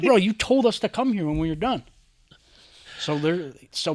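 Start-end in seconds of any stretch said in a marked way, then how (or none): 0.0-1.8 incredulous retort. Retold or imitated. Friends answering the yelling roommate.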